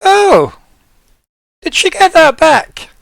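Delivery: loud, high, slow exclamation; surprised sloth. Acted. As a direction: react with big, drawn-out surprise. Sloth learns about the sword.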